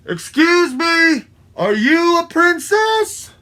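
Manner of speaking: high, loud, slow call; drawn-out high shouted question. Acted. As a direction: call up to someone in the treetops. Calling up to treetop woodpecker.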